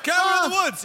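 high-pitched excited call-out; playful high heckler's cry; mock heckle. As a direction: holler it like a helpful audience heckler. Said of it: "affirming his helpful heckle".

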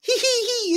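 high-pitched playful giggle; little kid's giggle. Acted. Little kid chasing goblins, high giggle. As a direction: giggle like a tiny child on the chase. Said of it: "she's looking like".

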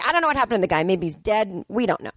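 ordinary delivery

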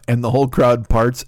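ordinary delivery

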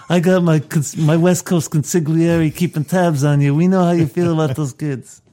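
lightly voiced crime-boss impression; mock Godfather. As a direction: push it out as a warning from the Don. Playing the Don warning Johnny.

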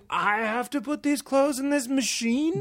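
high incredulous whine; spoiled disbelief. Retold or imitated. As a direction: act appalled at chores. Spoiled New Yorker aghast.

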